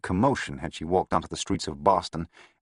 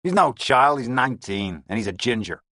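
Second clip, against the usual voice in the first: gruff gangster boss; Lavelle voice; 4 semitones higher, 4 dB louder than usual. A narrator's reading. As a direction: growl it like a crooked boss. Lavelle retorts.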